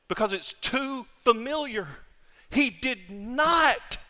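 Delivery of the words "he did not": "He did not" drawn out very slowly and higher, NOT hit hard; emphasis on NOT. Shown as a demonstration. Preacher repeating the point so the church really hears it. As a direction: stretch "He did not" so nobody misses it. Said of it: "I say it with emphasis".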